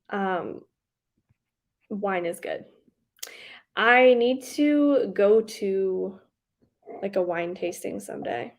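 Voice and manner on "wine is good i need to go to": normal talking voice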